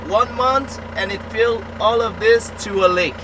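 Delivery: ordinary talk, nothing marked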